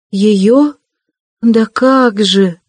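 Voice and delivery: slow, drawn-out plaintive answer; plaintive drawl. A narrator's reading. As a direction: stretch it out pitifully and slowly. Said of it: "протянула Соня жалобно".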